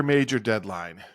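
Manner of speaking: usual speaking voice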